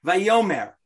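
ordinary delivery

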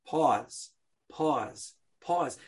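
each pause said slowly and softly, spaced apart. Shown as a demonstration. Teacher spacing out the pauses for the class. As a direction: let each pause hang. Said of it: "the way it begins is pause".

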